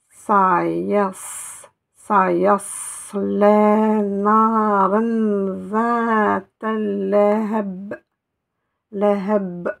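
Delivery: slow, drawn-out recited words; Quranic recitation. Shown as a demonstration. Teacher reciting the verse, slow and drawn out. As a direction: chant the verse slowly to the class. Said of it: "reading the verse aloud".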